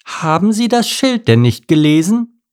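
put-on high-pitched reproachful old lady; scolding old lady. A narrator's reading. Old lady scolds Mike about sign.

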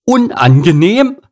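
high, loud screech of disbelief; indignant cow screech; shrieking. A narrator's reading. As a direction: shriek it back as an outraged question. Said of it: "kreischte Kuni".

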